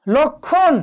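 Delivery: teacher's model of লক্ষণ without any m; silent ম-ফলা. Shown as a demonstration. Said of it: "উচ্চারণটি হলো না".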